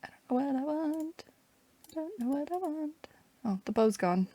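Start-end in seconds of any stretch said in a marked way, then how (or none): 0.0-1.2 much higher, softer, squeaked out; a small put-on squeaky voice wondering aloud. Repeating line in put-on voice. 1.9-3.1 much higher, softer, squeaked out; a small put-on squeaky voice wondering aloud. Repeating line in put-on voice.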